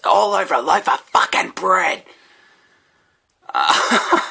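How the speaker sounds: put-on high, loud aggrieved drunk; homeless guy's voice. Homeless man ranting about convicts and bread, mock outrage. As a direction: ham up the indignant rant. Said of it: "he goes".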